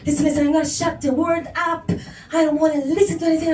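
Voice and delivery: hurried, pleading self-talk; rushed inner rant. Acted. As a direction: rush it out, shutting the world up. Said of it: "I'm like".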